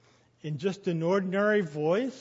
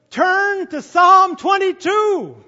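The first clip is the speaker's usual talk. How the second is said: higher, shouted, slow call; loud call; 11 semitones higher, 14 dB louder, slower than usual. Acted. Jesus calling loudly to distant disciples, shouted. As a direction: shout it slowly to the far-off crowd. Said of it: "saying in a loud voice".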